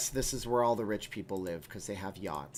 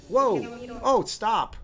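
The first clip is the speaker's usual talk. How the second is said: sudden high, loud yelp of real shock; real startled outcry; startled shout; 11 semitones higher, 7 dB louder, much slower than usual. Real, not acted. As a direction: jump back as a loud clip blasts your ears. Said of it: "that just blew my eardrums out".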